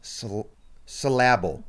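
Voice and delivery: slow, careful "syllable" as suh-LAB-ul; wrong-stress "syllable". Shown as a demonstration. Host copying the co-host's joke word, slow "syllable" as suh-LAB-ul. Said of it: "Please say the word".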